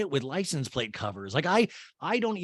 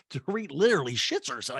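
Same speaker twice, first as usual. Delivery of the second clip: normal talking voice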